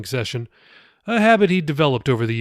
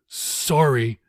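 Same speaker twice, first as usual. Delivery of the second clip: ordinary delivery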